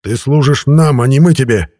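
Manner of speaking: loud, forceful put-down; growled retort. A narrator's reading. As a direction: hammer it home angrily. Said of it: "прорычал я".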